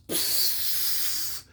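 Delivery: a soft unvoiced psst of peeing; hissing pee sound. Mock pee hiss while faking the seizure. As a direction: make the hiss of a stream. Imitating pissing.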